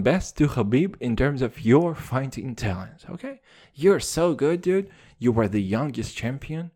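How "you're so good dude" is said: usual speaking voice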